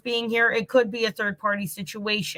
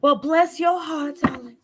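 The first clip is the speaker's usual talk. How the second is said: Southern belle; 4 semitones higher than usual. Pretend. Reader playing at her southern things for viewers, Southern belle accent. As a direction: lay on a syrupy mock Southern accent. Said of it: "my southern things".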